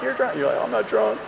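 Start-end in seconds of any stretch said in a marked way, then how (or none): 0.0-0.4 high mock mother, softer on the repeat; the repeat trails off quieter; shrieking mom, fading. Mom repeats, smacking him. 0.6-1.2 whispered start, then a higher protest; mock drunk denial. Drunk son denies to mom.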